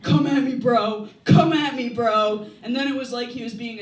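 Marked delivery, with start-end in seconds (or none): 0.0-1.1 loud, higher bro challenge; tough guy taunt. Retold or imitated. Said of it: "some six-foot-four dude going". 1.2-2.5 louder tough guy taunt. Retold or imitated. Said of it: "some six-foot-four dude going".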